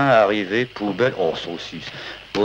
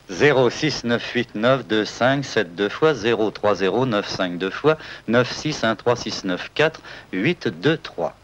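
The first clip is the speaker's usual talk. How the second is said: reading digits slowly; slow dictation; slower than usual. Acted. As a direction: spell out each number. Reading out a number code.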